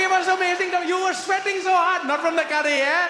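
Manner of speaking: put-on high, loud Indian-accented waiter; indignant, high-pitched Indian accent. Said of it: "you start talking with accent".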